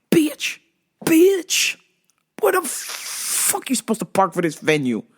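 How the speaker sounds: high-pitched exasperated yell at the signs; mock yell. Yelling back at the parking sign, exasperated. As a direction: bitch flung out high, then a grumbling question.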